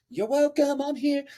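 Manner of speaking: high, airy show-off voice; high, smug self-satisfied voice; smug Lucifer. Retold or imitated. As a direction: preen like a vain angel. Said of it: "He's like".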